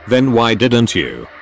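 ordinary delivery

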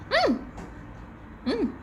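high bright yummy hums; delighted hum. Real, not acted. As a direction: squeal of delight tasting. Delighted tasting.

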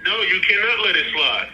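normal talking voice

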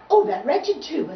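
high, loud, snappish complaint; snapped. A narrator's reading. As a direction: snap it out in storybook annoyance. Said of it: "snapped the violins".

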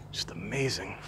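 awed whisper. Real, not acted. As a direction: breathe it out in awe. Awed at storm damage.

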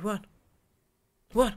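ordinary delivery

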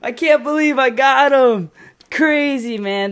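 high, loud, drawn-out cheering; excited disbelief. Real, not acted. Player celebrating a kill he thought impossible, excited disbelief. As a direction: stretch the words out in thrilled disbelief.

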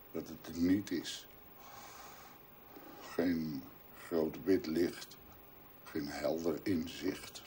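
a quiet, despairing voice about emptiness and hell; hushed dread. Acted. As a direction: murmur into the void. Dark hushed monologue about void and hell.

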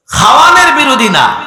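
high, loud declaration; emphatic protest. Real, not acted. Preacher correcting a misunderstanding for the crowd, high emphatic protest. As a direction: cry it out as a firm clarification.

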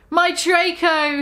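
a mock mournful moan; lightly acted grief. A narrator's reading. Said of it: "he moaned".

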